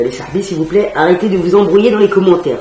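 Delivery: loud, stern scolding of the viewers; loud telling-off to fighting commenters. Real, not acted. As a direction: tell the fans off, full volume.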